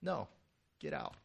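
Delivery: low, curt refusal; brief, sharp. Acted. Jesus refusing to give the demon time, curt. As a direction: dismiss it shortly, without raising your voice. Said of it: "brief and sharp".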